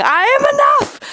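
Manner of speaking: mock triumphant self-affirmation; triumphant declaration. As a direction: shout it like a victory. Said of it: "affirmation declared".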